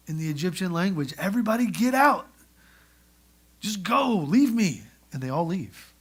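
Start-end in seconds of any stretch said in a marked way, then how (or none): 1.2-4.8 loud, higher call to clear the room; hollering out. Retold or imitated. Joseph clearing the room before revealing himself, hollering. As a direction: bellow at everyone to get out. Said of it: "So he hollers out".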